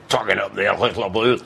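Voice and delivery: garbled mock-Scottish babble; mock Gaelic. Comic gives the supposed Gaelic meaning, in mock Scottish babble. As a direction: gabble it as made-up Gaelic. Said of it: "Auchterarder is Scottish for, or Gaelic".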